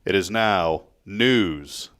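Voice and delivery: slow, low segment-title read; deep slow radio intro; announcer voice. Acted. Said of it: "theme music coming one day for this segment".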